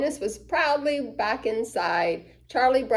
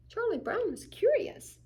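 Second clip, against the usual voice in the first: curious; 11 semitones higher, 4 dB quieter than usual. A narrator's reading. Narrator voicing curiosity.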